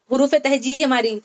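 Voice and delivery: usual speaking voice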